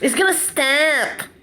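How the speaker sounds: mocking promise. Vlogger mocking promises he keeps hearing, lightly mimicked. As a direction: parrot the empty promise they keep giving. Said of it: "That's all I'm hearing".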